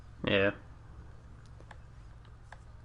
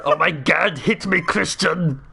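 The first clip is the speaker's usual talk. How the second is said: high, hammed-up action-star cry; Arnold Schwarzenegger; 6 semitones higher, slower than usual. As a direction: drag out the plea, Austrian accent. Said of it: "it was just Arnold Schwarzenegger instead".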